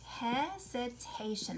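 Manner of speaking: "Hesitation" slowly, in separate beats; syllable by syllable. Shown as a demonstration. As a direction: sound out each syllable of "Hesitation". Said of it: "That has lots of syllables".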